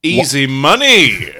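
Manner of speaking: loud, drawn-out exclamation with a swooping peak; stretched, over-the-top cry; mock-gleeful proclamation. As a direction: belt it out slowly like a showy catchphrase. Gleeful echo of phrase.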